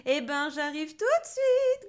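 high, loud, slow cackly voice; high-pitched gleeful witch; chortling witch. A narrator's reading. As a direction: cluck it out with glee. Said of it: "gloussa-t-elle".

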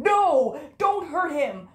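high, loud plea to stop; desperate shout. Acted. Captive shouting at the villain to spare him. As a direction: cry out to save him. Pleading for someone's life.

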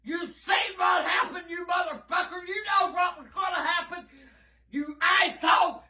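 normal talking voice

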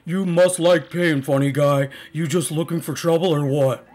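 loud, deliberate goon threat; funny voice. Acted. Second henchman threatening the visitor, funny tough-guy voice. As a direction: menace him like a cartoon heavy. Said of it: "I wanna do some funny voices".

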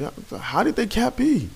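high, slow, outraged disbelief; incredulous. Real, not acted. Gamer asking his viewers in slow, high disbelief. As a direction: stretch out the question in disbelief. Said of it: "I hate to curse, but, like, seriously".

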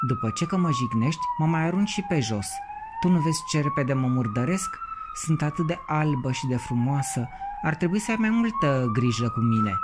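ruffled lady tells him off; bristling. A narrator's reading. Offended cauliflower lady scolds the boy, bristling. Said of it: "se zbârli doamna Conopidă".

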